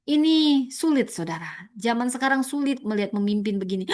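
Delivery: ordinary talk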